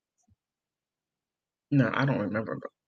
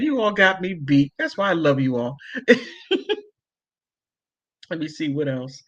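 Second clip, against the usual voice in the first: usual speaking voice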